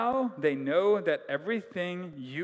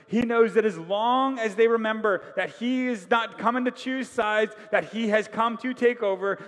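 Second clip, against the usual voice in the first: louder, higher, urgent sermon build; fervent preaching; 5 semitones higher, 6 dB louder than usual. Real, not acted. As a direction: press the congregation hard.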